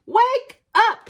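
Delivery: high loud wake-up call. A narrator's reading. Puffin calling loudly to wake Hetty. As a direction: yell it to rouse her.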